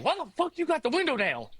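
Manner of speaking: mock outraged wake-up; annoyed friend woken up. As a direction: snap at him like he just woke up cold. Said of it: "and is like".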